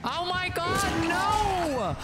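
high cry of dismay; high, drawn-out horrified "no"; alarmed cry. Real, not acted. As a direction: cry out as you fall into danger. Falls right into the killer.

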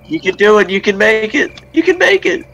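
high, loud screamed urging; screaming. Real, not acted. Player urging a teammate to make it, high frantic screaming. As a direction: scream your teammate on, rising in pitch. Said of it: "Why is everybody screaming?".

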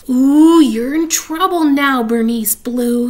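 loud taunting shout; gloating yell. Acted. Older sister threatening Bean, loud gloating yell. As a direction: yell it, triumphant and tattling. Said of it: "yelled Nancy".

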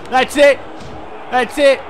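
loud, high triumphant yell; excited shout. Real, not acted. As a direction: celebrate the win out loud. Cheering a win in game.

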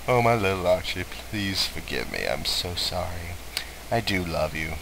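tender mother. Acted. Voicing mother's tender apology.

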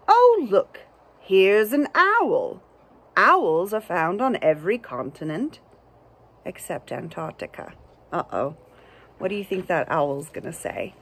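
ordinary delivery

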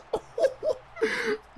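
shrieking laugh at a wild replay; high shrieking laugh. Real, not acted. Reacting to a crazy play.

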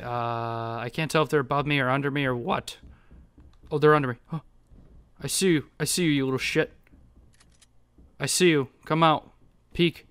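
usual speaking voice

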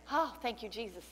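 normal talking voice

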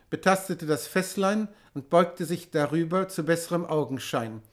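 usual speaking voice

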